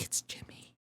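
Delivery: breathless excited whisper. Retold or imitated. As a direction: whisper it to him during the movie. Explaining to husband in theater.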